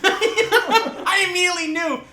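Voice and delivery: high, slow, laughing through the words; high laughing mock repeat. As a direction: giggle the line back.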